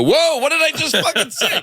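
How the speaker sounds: very high, laughing mock outrage. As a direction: shriek it as fake shock while cracking up. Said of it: "you go".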